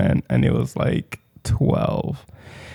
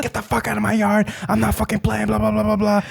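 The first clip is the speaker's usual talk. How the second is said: higher, mocking take on the neighbor's rant; imitating her yelling; 8 semitones higher, 4 dB quieter than usual. Friend mocks the neighbor's second outburst, imitated rage. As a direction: parody the lady losing it. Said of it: "She's like".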